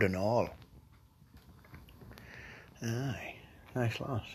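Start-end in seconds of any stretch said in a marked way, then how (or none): 2.8-4.2 breathy whispered aside; a sly whispered aside. Real, not acted. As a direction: drop to a whisper, to yourself. Mumbling aside about a girl.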